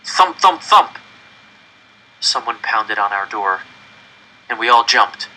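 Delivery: ordinary delivery, nothing marked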